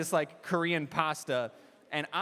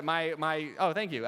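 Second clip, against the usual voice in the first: usual speaking voice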